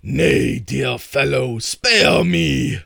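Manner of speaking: loud, high begging troll; pleading troll. Acted. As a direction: beg for your life like a cowed troll. Troll begs for mercy.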